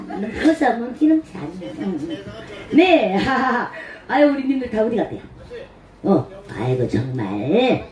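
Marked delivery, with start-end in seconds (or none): none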